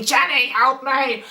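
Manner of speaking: mild mock yell for help; yelling in pain. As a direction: imitate a hurting dad calling his wife. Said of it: "He's yelling in pain".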